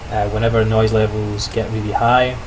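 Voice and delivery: normal talking voice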